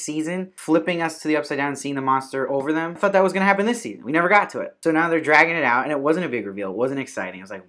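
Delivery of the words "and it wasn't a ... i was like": ordinary delivery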